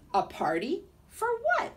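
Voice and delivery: high, squeaky rising question; a little creature amazed; amazed lizard. Acted.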